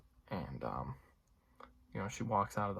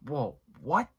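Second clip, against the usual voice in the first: louder, rising "Whoa, what?"; shocked whoa; 4 semitones higher, 6 dB louder than usual. Retold or imitated. Vlogger replaying his theater reaction to the throat slit, shocked. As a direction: react as if the twist just hit you. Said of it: "pretty much verbatim my reaction".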